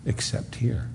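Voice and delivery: whispered, breathy emphasis; hushed. Real, not acted. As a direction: drop to a whisper on the point. Preacher drops to hushed emphasis.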